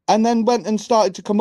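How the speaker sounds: ordinary talk, nothing marked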